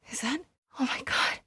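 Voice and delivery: frightened breathless gasp; shocked, barely voiced; terrified whisper. Real, not acted. Sudden danger discovered.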